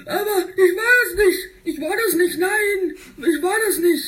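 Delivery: high, half-laughing, half-crying denial; whiny denial. Acted. Spike denying he cheated, high whiny protest. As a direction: protest like a caught child.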